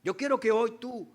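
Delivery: usual speaking voice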